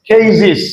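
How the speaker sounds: কেজিস ending in "-ziz"; new "-iz" ending. Shown as a demonstration. Teacher showing the corrected way, কেজিস with "-ziz". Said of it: "এখন বলবো আমরা কেজিস".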